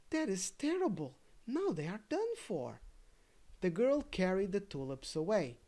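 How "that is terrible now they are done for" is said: higher, lightly sighed lament; a mild sigh of pity; sighed dismay. Acted. Daisy watching tulips cut, mild sighing dismay. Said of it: "sighed the daisy".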